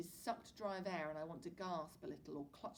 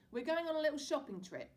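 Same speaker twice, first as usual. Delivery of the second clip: louder, higher cheery dad; tossing treats, jolly father; 9 semitones higher, 8 dB louder than usual. A narrator's reading. Dad to his boys, loud cheery father voice.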